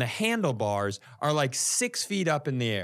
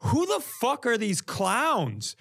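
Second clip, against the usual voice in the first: higher, slow, baffled; retold baffled swearing; incredulous outburst; 8 semitones higher, slower than usual. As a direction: blurt it out in disbelief. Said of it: "I go".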